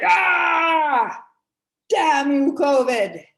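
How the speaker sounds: loud, high frustrated yell at covid; venting shout. Real, not acted. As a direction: let it all out in one big shout. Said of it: "got that out of my system".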